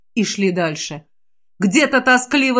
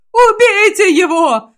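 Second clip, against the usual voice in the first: shrieking; 11 semitones higher, 6 dB louder, slower than usual. Acted. Sabron orders the mage killed, shrieking. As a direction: voice breaks into a shrill, slow shriek. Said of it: "Его голос сорвался на визг".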